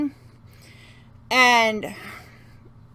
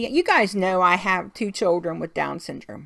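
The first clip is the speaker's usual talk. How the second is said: ordinary delivery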